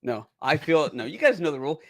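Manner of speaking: usual speaking voice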